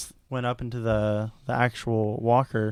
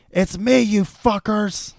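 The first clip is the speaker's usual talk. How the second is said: high, loud, slow mock yell at the others; frustrated yell; 10 semitones higher, 7 dB louder, slower than usual. Luke yells at his friends, high loud frustrated mock yell. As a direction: yell it like a fed-up hero stuck hanging. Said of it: "what he says is".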